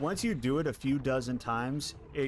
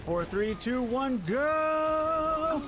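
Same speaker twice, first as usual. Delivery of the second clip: rising pitch to a shout; excited countdown; 14 semitones higher, 3 dB louder, much slower than usual. Real, not acted. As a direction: count down and yell go. Countdown shouted at game start.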